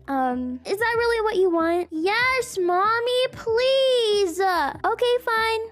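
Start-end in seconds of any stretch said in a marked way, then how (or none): 1.9-4.4 put-on high, whiny kid begging; little boy pleading. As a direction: beg mom like a small boy. Son voiced pleading.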